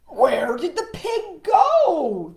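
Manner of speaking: loud, high, stretched-out question; slow, dramatic storybook cry. Acted. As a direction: wail it as baffled animals. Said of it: "the animals cried".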